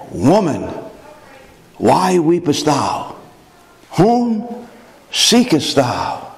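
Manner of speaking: slow, measured, tender King James phrasing; voice of Jesus. Acted. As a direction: draw each word out gently. Jesus speaks to Mary.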